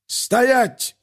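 high, angry bark. A narrator's reading. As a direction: bark the order to freeze. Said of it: "злой голос рявкнул".

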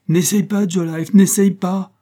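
high, loud panicked plea; frightened cry. Acted. Wife crying out in fear to stop the corporal's whip. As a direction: beg him to stop, terrified. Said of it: "s'écria la petite femme, effrayée au plus haut point".

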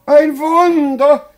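high, loud jubilant shout; ecstatic cry. Acted. Invalid announces his miraculous cure, ecstatic. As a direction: proclaim it at the top of your voice.

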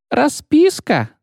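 high, slow shriek; shrieking clerk. Acted. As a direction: squeal the word out.

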